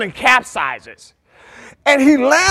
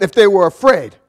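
usual speaking voice

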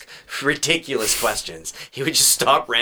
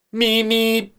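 high-pitched little horn beeps; squeaky two-note beep; imitated moped horn; 10 semitones higher than usual. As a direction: toot it like a tiny moped horn. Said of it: "gives them a little toot".

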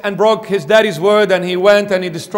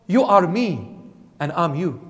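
ordinary talk, nothing marked

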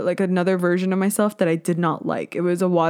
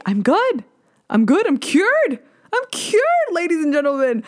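triumphant proclamation; 8 semitones higher than usual. Acted. Host declaring herself cured of heartbreak to listeners, triumphant. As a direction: proclaim it high to an audience. Mock announcement of being cured after breakup.

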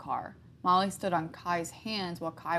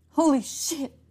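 shocked mutter; 8 semitones higher, 5 dB louder than usual. A narrator's reading. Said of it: "Kai muttered".

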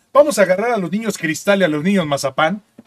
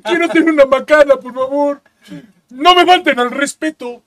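much higher, louder, slowing huffy protest; mock offended persona; 8 semitones higher, 5 dB louder than usual. Playing someone offended at a slur, hammed-up high huffy protest. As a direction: demand respect like a hammed-up offended diva.

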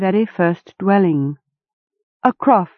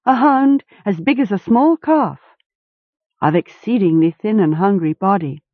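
usual speaking voice

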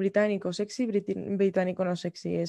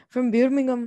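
higher-pitched Brummie imitation; Birmingham accent; 4 semitones higher, 4 dB louder, slower than usual. Shown as a demonstration. Imitating Peaky Blinders side characters, higher Birmingham accent. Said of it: "el acento de Birmingham".

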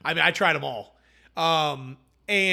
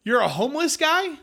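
much higher and louder, puzzled call back; shouted confused question; 11 semitones higher, 6 dB louder than usual. Retold or imitated. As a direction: yell back, mishearing. Lady shouts back across the field.

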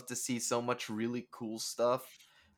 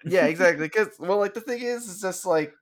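excited; 10 semitones higher, 9 dB louder than usual. Real, not acted. Excited, creeped out.

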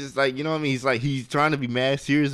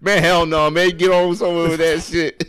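loud, higher mock-indignant refusal; back to outward face; 5 semitones higher, 5 dB louder than usual. Man sends the crying suitor away, loud mock indignation. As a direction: snap out of it and dismiss him. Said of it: "it goes back to his face".

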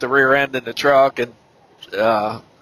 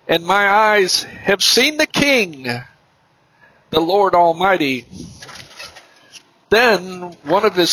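high, slow, solemn Bible reading; measured, ringing recitation of a prophet's words; scripture reading; 6 semitones higher, slower than usual. Acted. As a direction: declaim scripture slowly, pitch lifted, with long pauses. Reads Isaiah aloud at length.

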